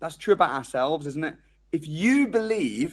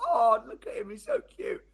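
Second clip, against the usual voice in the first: doting coo; 6 semitones higher than usual. Retold or imitated. Parent cooing fondly over their baby. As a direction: gush over your baby like a besotted parent. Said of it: "I'm like".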